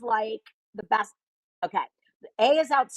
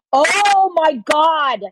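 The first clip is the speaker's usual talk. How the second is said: gasping, thrilled Oh my God; loud, slow delighted shriek; excited gasp; 8 semitones higher, 10 dB louder, much slower than usual. Real, not acted. Hears the game idea.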